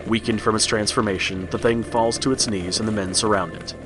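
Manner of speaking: plain reading voice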